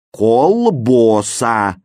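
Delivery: «колбоса» loud and slow, both first vowels O; drawn-out «колбоса» with full round O sounds; misspelling read out. A narrator's reading. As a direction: sound out KOL-BO-SA in «колбоса», stretched. Misspelt word read as written.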